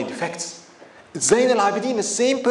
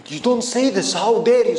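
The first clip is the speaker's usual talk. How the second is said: raised, louder mock outrage; retold protest, pitched up; upset; 4 semitones higher, 5 dB louder than usual. Bystanders rebuking the slanderer, retold upset. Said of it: "So people got really upset".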